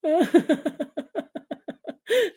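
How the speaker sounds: high, breathy run of laughter; laughing fit. Real, not acted. Host breaks up over viewers' comments, helpless laughter. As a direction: dissolve into helpless giggles.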